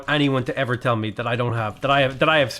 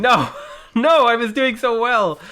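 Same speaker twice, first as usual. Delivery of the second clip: laughing despair; 9 semitones higher, 4 dB louder, slower than usual. Real, not acted. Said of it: "this is embarrassing".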